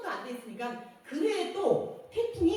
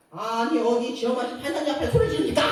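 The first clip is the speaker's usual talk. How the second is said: louder, rebuking courtroom authority; acted scolding judge; 8 dB louder than usual. Judge rebuking a litigant for shouting, loud scolding. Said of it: "그때 판사가".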